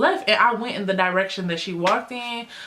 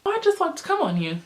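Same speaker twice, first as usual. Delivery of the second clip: high, faster teasing repeat; mock flirty; 10 semitones higher, faster than usual.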